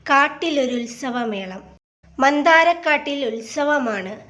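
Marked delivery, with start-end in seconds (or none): none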